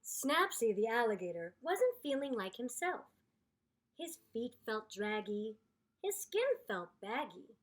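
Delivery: storybook read-aloud voice; story narration. A narrator's reading. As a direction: read it like a picture book for kids.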